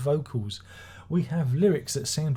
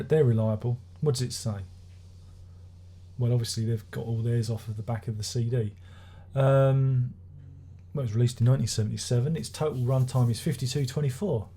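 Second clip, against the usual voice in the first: usual speaking voice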